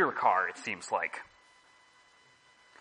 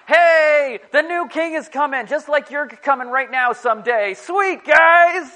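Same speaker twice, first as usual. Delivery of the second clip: higher, louder cheering welcome; excited greeting; 8 semitones higher, 10 dB louder than usual. Retold or imitated. Festival crowd hailing a popular person, cheering loudly. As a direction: whoop it like a crowd hyping a visitor. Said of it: "they'll be like".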